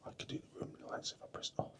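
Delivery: hushed whisper so sleepers aren't woken. Real, not acted. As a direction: keep it to a soft breathy whisper. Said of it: "I'm whispering because people might be asleep".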